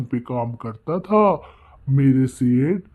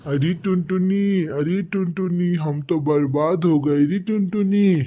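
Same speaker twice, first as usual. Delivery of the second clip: lightly acted wail of a husband; understated acted whimpering; tearful wailing. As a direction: lament it in mock despair, without real sobs. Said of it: "रोता बिलबिलाता हुआ घर आता है".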